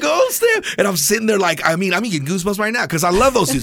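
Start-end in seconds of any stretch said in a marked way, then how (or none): 0.0-0.6 high, thrilled yelp; excited exclaim. Retold or imitated. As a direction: blurt it out, amazed. Retelling seeing his heroes.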